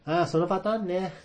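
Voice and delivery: high, mock-bored know-it-all; knowing dismissive. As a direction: brush it off like an old hand.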